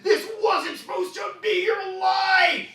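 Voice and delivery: high, loud, slow anguished yell; desperate shout. Acted. Older self shaking the kid, high slow shout. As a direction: yell it as a scarred man shaking his young self. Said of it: "shakes the kid and goes".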